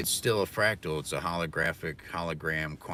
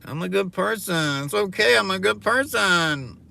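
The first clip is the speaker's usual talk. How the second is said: mocking self-righteous girl; 11 semitones higher, 8 dB louder than usual. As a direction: drag it out like a smug girl. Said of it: "my impression of a girl who thinks she's a good person".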